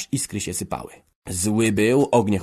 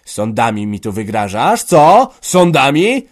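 loud questions leaping up in pitch; outraged; 4 semitones higher, 11 dB louder, slower than usual. Acted. Father challenged with courts by son, outraged. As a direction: throw the threat back, louder and higher. Father answers son's threat.

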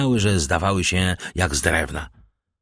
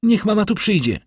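much higher, louder, frantic request; urgent call; 13 semitones higher, 4 dB louder than usual. A narrator's reading. Valentine calls for smelling salts, frantic. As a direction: shout the request down the stairs. Calling down the stairs.

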